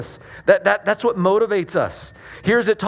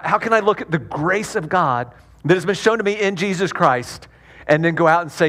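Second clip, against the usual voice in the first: usual speaking voice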